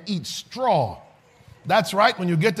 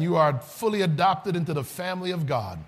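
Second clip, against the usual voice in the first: ordinary talk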